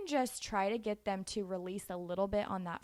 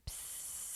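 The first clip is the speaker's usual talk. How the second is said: a soft voiceless psst like trickling pee; whispering a pee sound in the toddler's ear; whispered psst; 14 dB quieter than usual. Shown as a demonstration. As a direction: hiss a tiny psst into her ear. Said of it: "And I would whisper in her ear".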